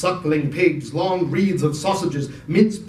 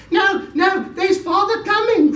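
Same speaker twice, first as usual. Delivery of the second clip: put-on very high, loud child voices; little kids warning; excited children; 3 dB louder than usual. Children warn Martha.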